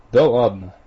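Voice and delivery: loud, dismissive rector; loud brush-off. Acted. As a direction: wave it off out loud. Rector waves it off.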